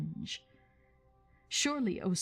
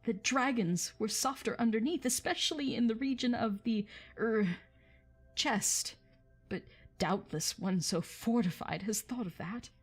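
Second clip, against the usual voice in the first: higher, nervous hobbit squeak; timid hobbit probing the dragon, higher; frightened squeak; 5 semitones higher than usual. A narrator's reading. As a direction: squeak it out, trying to sound bold. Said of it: "in a frightened squeak".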